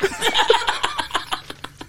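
breathless high-pitched giggle; high squealing laugh. Real, not acted. Laughing fit after restart.

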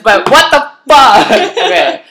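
high laughing what the fuck; cracking up mid-complaint; laughing shocked outburst; laughing outrage. Real, not acted.

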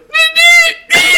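very high, loud strangled yelps; imitated pained squeal. Poops answering Troy from the ground, imitated pained squeals. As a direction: squeal as if unable to get words out. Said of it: "that's all he's getting out".

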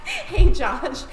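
high, loud bubbly hello; excited greeting. Pretend. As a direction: greet him all bubbly. Answers the host's mock greeting.

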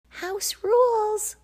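very high, loud, drawn-out chant; chorus of small animals. Acted. Jostled lemmings shouting house rules at huskies, very high. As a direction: squeak it out together like tiny critters. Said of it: "chorused the jostled lemmings".

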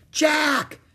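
high, loud long-distance shout; excited high-pitched yell; calling out. A narrator's reading. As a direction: holler a name from the woods. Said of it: "Annie called from the woods".

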